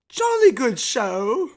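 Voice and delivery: put-on high, slow, loud posh British accent; hammed-up British gentleman; British accent, jolly old chap; mock British Pops.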